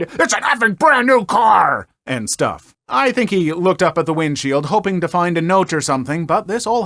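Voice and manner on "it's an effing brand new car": loud, high furious yell; imitating a furious dad shouting; retold angry shout. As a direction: rage at the dented door. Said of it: "screaming things like".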